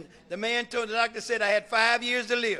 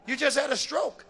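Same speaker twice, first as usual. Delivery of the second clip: retold startled question, rising at the end; incredulous echo; 6 semitones higher than usual. Bishop echoes sister's rumour, mock incredulous. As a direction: throw it back as a question.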